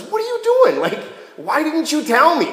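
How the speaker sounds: high, outraged king demanding answers; indignant Abimelech. Retold or imitated. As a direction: sputter at him in shocked disbelief. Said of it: "And Abimelech says".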